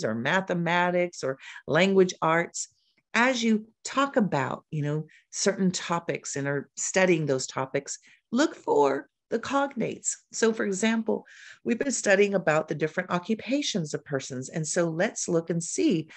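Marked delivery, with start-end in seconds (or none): none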